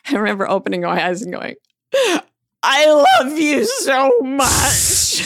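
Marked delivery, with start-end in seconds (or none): 2.6-5.2 slow, loud, high gushing declaration; gushing love. Retold or imitated. Waking patient to a janitor, gushing love. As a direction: stretch it out in a blissed-out, woozy gush.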